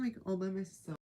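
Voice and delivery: ordinary delivery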